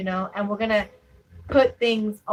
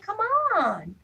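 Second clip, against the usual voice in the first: high, exasperated come on, move; higher pitched mock-impatient push; 9 semitones higher, much slower than usual. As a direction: urge the crowd to move, fed up. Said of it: "And I'm like".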